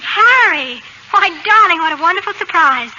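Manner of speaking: high, loud flustered cry of delight; overdone surprise at the husband's return; startled gushing greeting. Acted. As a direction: cover being caught with a bright greeting. Wife caught, husband returns unexpectedly.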